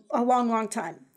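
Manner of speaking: normal talking voice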